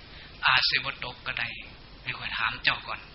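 slow, soft Isan reassurance; calm a crier in Isan; Isan dialect. Retold or imitated. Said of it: "ผู้ให้ก็บอกให้เศร้าซะก่อน". (ภาษาอีสานช้าๆ เบาๆ)

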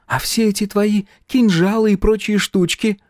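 put-on high-pitched girl's voice; high girlish character voice. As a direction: voice a chatty girl, pitched well up. Girl challenges the hero.

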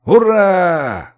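loud, high, drawn-out hurrah; yelled cheer. A narrator's reading. The men rejoicing that Зоя will come, yelled cheer. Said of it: "хором заорали мужчины".

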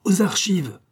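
high-pitched rallying exclamation; urgent call to action; rallying cry. A narrator's reading. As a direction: cry it out to lead the charge. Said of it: "s'écria Gilbert".

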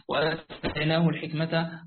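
ordinary talk

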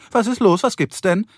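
high-pitched calling out; alarmed call. A narrator's reading. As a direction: call out in concern from another room. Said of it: "rief Carrie".

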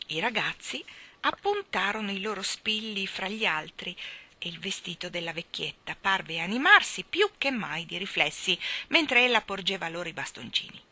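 ordinary delivery

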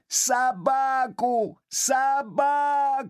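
put-on very high, slow drawn-out child's wail; shrieking boy. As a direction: wail the word like a spoiled brat. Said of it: "залился громче прежнего мальчик".

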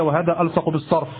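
normal talking voice

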